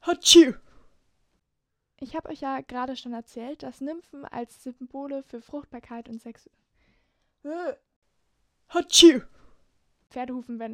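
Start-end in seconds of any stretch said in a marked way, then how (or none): 0.0-0.5 loud sudden sneeze; big explosive sneeze; sneezing. Real, not acted. Outtakes full of sneezes. 8.7-9.2 loud sudden sneeze; big explosive sneeze; sneezing. Real, not acted. Outtakes full of sneezes.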